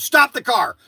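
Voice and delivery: imitating son shouting to stop the car; son demanding. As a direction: blurt it out like an eager teen. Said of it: "all of a sudden Frankie goes".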